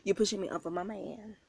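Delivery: usual speaking voice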